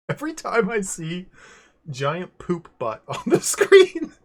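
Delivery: high, loud, breaking into laughter; tickled, giggling delivery; laughing through words. Real, not acted. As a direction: try to get it out while cracking up. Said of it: "I laugh".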